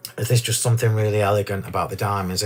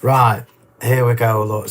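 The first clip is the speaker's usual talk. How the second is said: ordinary talk, nothing marked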